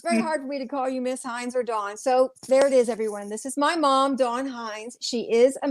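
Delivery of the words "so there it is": ordinary talk, nothing marked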